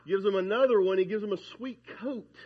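ordinary talk

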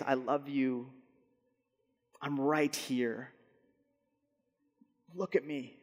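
put-on soft, slow soothing to a baby; tender hush. Father soothing his crying newborn, softly tender. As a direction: murmur close to a crying infant.

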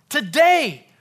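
"Today" shouted high, falling at the end; very loud, high TODAY; stress on TODAY. Shown as a demonstration. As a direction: blast "Today" as one emphatic word. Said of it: "just emphasize a different word".

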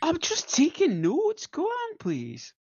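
very high, drawn-out innocent plea; feigned innocence. Acted. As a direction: play innocent in a high, slow voice.

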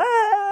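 loud, high imitated scream; child's scream. Preacher imitating his son waking in the night, high loud scream. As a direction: shriek like a child waking in fright. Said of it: "the first one starts screaming".

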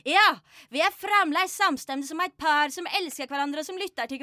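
loud, high, breathless-tumbling lovers' rapture; hammed-up orgasmic declamation in Nynorsk; ecstatic chorus in Nynorsk. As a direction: build to a shouted frenzy of passion. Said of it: "adoptivforeldrene sier i kor".

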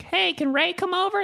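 put-on high childlike kid voice; kindergartner asking. Kid at a friend's door asking politely. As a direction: ask like a little kid at the door. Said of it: "I'd be like".

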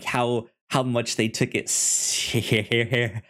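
ordinary delivery

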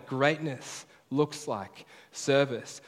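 Greatness looks like service, each word drawn out very slowly; very slow, deliberate refrain; very slow emphatic. Shown as a demonstration. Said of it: "that's the big idea".

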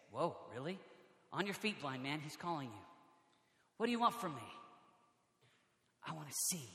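very soft, low acted exchange; hushed dialogue. Preacher voicing Jesus and the blind man, hushed. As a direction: play each line softly and low.

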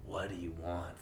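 quiet, hesitant question; unsure president. Retold or imitated. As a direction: ask it weakly and unsure. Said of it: "he says it in such a way".

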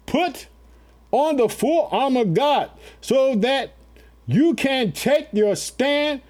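slow, lifted scripture reading; scripture proclaimed. Acted. Preacher reading the armor verse to the family, slow and lifted. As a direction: read each word out slowly and grandly. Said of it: "Did you hear it?".

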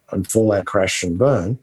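ordinary talk, nothing marked

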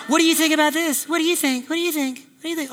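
high, repeated asking, fading away; eager admirer. Acted. Acted admirer asking his opinion.

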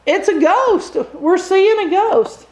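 hammed-up scared shout, pitched high; mock terrified scream. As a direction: play the frightened disciples shrieking. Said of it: "they start screaming".

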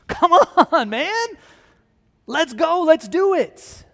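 loud, high, urgent pleading; impatient disciples. Acted. Disciples begging Jesus to get started, loud and impatient. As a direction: egg him on at full volume, stretching the last words.

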